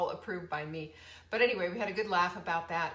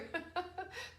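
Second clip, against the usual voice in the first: light laugh; 10 semitones higher, 7 dB quieter than usual. Real, not acted. Laughs at own joke.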